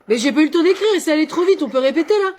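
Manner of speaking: flustered pupil. Acted. Pupil panicking that the dictation went too fast. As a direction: rush it out, panicked.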